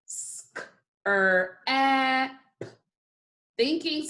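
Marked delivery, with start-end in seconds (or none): 0.1-2.3 SCRAP broken into sk-, er, ap; segmented phonics sounds, sk... er... ap; sounded out by phonemes. Shown as a demonstration. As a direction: say each sound of scrap separately, with pauses. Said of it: "One more time".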